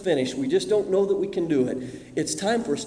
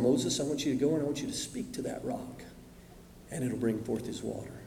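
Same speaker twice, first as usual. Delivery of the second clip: hushed divine voice; 8 semitones lower, 10 dB quieter than usual. A narrator's reading. God speaking quietly to Moses.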